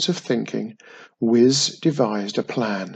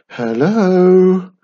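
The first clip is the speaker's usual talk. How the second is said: long, loud, higher hello; nervous calling; 6 semitones higher, 9 dB louder than usual. A narrator's reading. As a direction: call uncertainly into an empty hall. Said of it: "called Wiz nervously".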